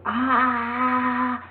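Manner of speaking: high crying wail; baby crying. Retold or imitated. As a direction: imitate the child still crying. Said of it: "when he was still".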